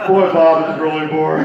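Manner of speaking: bored puppet. Acted. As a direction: whine about being bored. Puppet complains, laughing.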